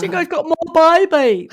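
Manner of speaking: hammed-up Meryl-style Australian; Australian impression. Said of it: "Poor Meryl".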